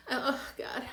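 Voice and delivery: laughing through real tears; half laugh, half cry; a real catch of tears in her laugh; real teary laugh. Real, not acted. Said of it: "I'm crying".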